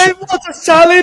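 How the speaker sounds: loud, very high put-on outburst; switched persona. Hosts joking about a mid-order personality switch, loud outburst. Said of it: "do you think he goes from the English".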